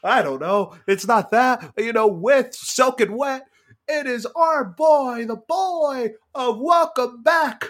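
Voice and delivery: big booming ring announcer, drawn out; boxing announcer intro. Pretend. Co-host announcing the actor like a boxer, loud and booming. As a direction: hype him up like a prizefighter walking out.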